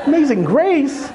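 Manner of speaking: high, slow mock disbelief with a laugh; incredulous. Pastor echoes the disciple in laughing disbelief. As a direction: echo it, stunned and amused.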